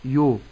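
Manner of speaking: "يو" opened into "yo"; mis-say "يو" with the u slackened to o; wrong tilted vowel. Shown as a demonstration. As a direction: demonstrate the faulty "yo" for "يو". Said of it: "إمالة الياء".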